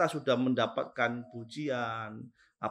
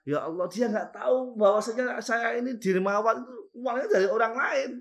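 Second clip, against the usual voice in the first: raised, higher cry to God; a pious leader crying out to God over praise, raised and higher; anguished lament; 10 semitones higher, 5 dB louder than usual. Acted. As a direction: plead out loud against undeserved praise. Ali laments being praised.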